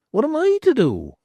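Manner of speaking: put-on a knowing old beldam; old hag's voice.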